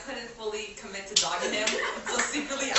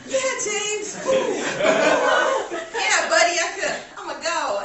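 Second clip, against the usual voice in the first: laughing; 12 semitones higher, 13 dB louder than usual. Retold or imitated. Mock-brushing off James, laughing.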